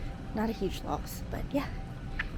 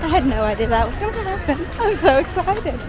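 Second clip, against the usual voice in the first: excited; 5 semitones higher than usual. Real, not acted. Floats appear unexpectedly.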